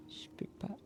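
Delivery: barely voiced whisper. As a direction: whisper the refusal. Quiet sad refusal.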